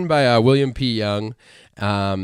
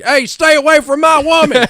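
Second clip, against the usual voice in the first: loud, high cowboy tough guy; old-timey actor voice; 10 dB louder than usual. Retold or imitated. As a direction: bark it like an old Western hero. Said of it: "trying to mimic an old, old-timey actor".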